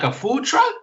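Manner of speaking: "food truck" jumps way up in disbelief; incredulous question. Retold or imitated. Teacher retelling his shock at the student, rising high. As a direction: pitch the question high, as if you can't believe it.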